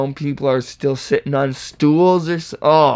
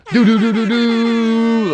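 high, held "doo-doo-doo"; mock rewind noise; 9 semitones higher, slower than usual. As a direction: imitate a rewinding sound, pitched up. Mimics a rewinding sound.

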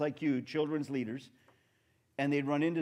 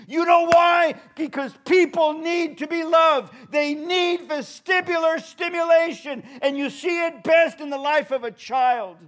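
much higher and louder, shouted sermon; full-force preaching; 12 semitones higher, 10 dB louder than usual. Acted. Preacher shouts to the church about love, preaching. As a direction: drive it home to the crowd.

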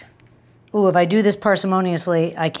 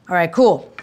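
usual speaking voice

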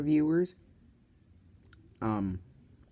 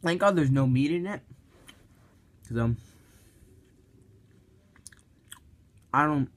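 speaking between bites; chewing while talking. Real, not acted. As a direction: mouth partly full. Seen 2: holds wrap, bites at 5.4-6.4, chewing lips between lines.

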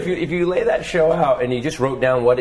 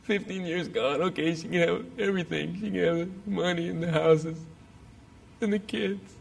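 hopeless resignation; 6 semitones higher, 6 dB quieter than usual. Retold or imitated. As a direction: let it go like a man who's given up. Despairing over divorce, suicidal.

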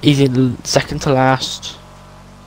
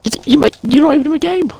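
incredulous yell; 12 semitones higher, much faster than usual. Real, not acted. Friend baffled he's not in the game, rising shout. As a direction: shout it, can't believe he's out.

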